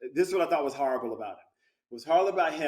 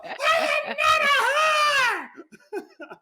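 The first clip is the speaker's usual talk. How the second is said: high, slow, loud laughing shriek; mock scream; 6 dB louder, much slower than usual. Repeating the set bit, a slow shriek breaking into laughter. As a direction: scream it, cracking up. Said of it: "she would yell that".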